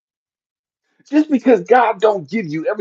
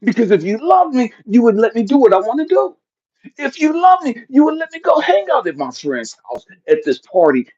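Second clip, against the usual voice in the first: put-on higher, whiny entitled child; mocking whiny kid; 7 semitones higher than usual. Comic mocking kids' demands, high and whiny. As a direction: mimic a spoiled kid.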